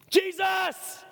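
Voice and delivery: sudden high-pitched yell of a name; desperate shout. Acted. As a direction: cry out JESUS to be noticed. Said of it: "what did he shout?".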